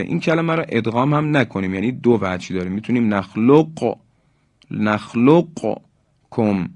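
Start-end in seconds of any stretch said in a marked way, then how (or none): 3.1-6.7 نخلقکم with an audible q release before kum; slow demo, ق and ک separate in نخلقکم; qaf pronounced, unmerged. Shown as a demonstration. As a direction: bounce the ق in نخلقکم, then say kum. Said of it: "قاف رو تلفظ کنیم".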